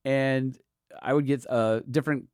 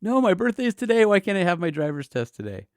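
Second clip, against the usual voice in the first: high, loud mock whine of complaint; bummed teenage protest; 10 semitones higher, 6 dB louder than usual. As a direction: protest like a let-down birthday kid. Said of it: "I was so bummed".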